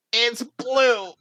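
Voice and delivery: high, loud nervous blurt; panicked outburst. Acted. Nervous Dennis to the volunteer, a high panicked shout.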